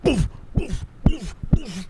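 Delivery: high puffed boofs; short repeated puffed thuds; imitated door thuds. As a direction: mouth the sound of banging on a door. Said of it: "it's just like".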